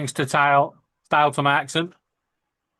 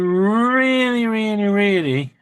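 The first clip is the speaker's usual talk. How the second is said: "really, really, really" stretched slow and higher; drawn-out emphasis; 6 semitones higher, slower than usual. Real, not acted. Advising how to upset him, long drawn-out "really, really, really". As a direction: draw out the first "really" very long, pitch raised.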